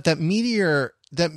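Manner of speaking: normal talking voice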